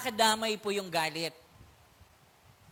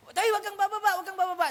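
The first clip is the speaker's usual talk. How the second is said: put-on high, loud urgent warning to a boy; urgent jeep driver; 9 semitones higher, 5 dB louder, faster than usual. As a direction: call out like a worried driver holding him back. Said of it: "Sabi ganun ng driver sa akin". (nagmamadaling babala ng drayber, mataas at malakas)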